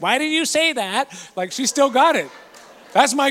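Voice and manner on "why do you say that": high-pitched grandma impression; nosy grandma. Grandma calling from two rooms away, high querying voice. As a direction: pipe up high like a sharp-eared grandma.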